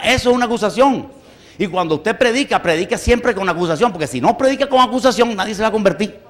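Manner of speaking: ordinary talk, nothing marked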